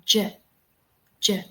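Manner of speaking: J said as a short "juh"; isolated J sound. Shown as a demonstration. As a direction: sound out J as "juh", not the letter name. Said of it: "listen to the sound".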